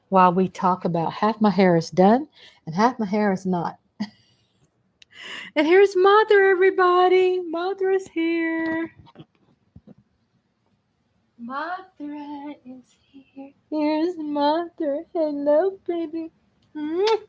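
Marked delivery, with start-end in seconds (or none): 5.6-17.2 high cooing pet voice. Real, not acted. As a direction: coo up high at the kitty. Cooing at her cat Mother.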